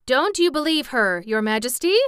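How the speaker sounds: loud, high-pitched protest; crying out. A narrator's reading. Jack warning the king not to believe her, crying out. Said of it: "cried Jack".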